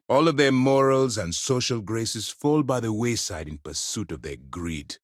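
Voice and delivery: usual speaking voice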